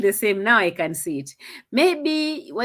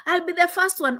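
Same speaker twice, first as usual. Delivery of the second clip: mimicking the hype; 9 semitones higher than usual. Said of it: "Fekere will say".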